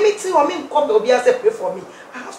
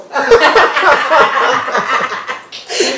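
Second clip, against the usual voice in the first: long, loud real laughter; loud burst of real laughter; laughing fit; 5 dB louder than usual. Real, not acted. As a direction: crack up at your own point. Cracks up repeating her point.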